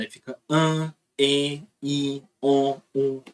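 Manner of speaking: each vowel said nasalized, one by one; teacher models the nasal vowels clearly. Shown as a demonstration. Teacher demonstrates nasal vowels to students, nasalized. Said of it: "Nasal é quando essa vogal é nasalizada".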